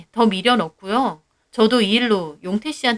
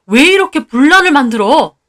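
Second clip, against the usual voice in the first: loud, higher complaint; exasperated husband; 4 semitones higher, 8 dB louder than usual. Acted. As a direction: blame her loudly. Husband arguing with wife. (짜증 난 남편의 큰소리)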